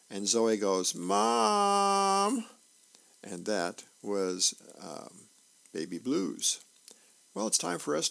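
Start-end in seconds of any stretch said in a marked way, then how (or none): 0.9-2.4 long, high, loud tattletale call; calling for mom. Acted. Sister tells on her brother, calling out for mother. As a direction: holler it out, stretched and pitched way up.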